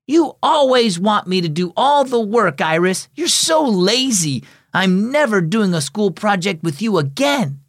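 put-on a girl snapping at her lazy friend; girl's angry outburst. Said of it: "Maria's voice boomed".

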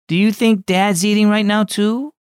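put-on a wistful child asking; young girl. Girl asks about absent dad.

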